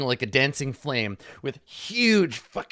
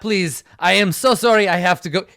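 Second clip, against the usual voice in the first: louder, higher hurried plea; flustered apology; 6 semitones higher, 8 dB louder, faster than usual. Acted. Smith apologizing to customers before running off, louder. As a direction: rush out the apology, desperate to chase after him. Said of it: "and he says".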